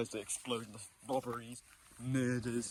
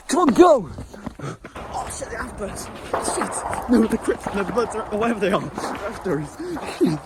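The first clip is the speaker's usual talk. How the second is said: high frantic shouts while fleeing; panicked yelling; 8 semitones higher, 14 dB louder than usual. Real, not acted. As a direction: yell in panic to keep running. Fleeing gang gunfire.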